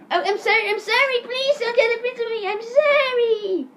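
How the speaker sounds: high, loud whiny begging; hammed-up apology, stretched at the end; mock pleading. Eric begs teacher not to tell principal, mock pleading.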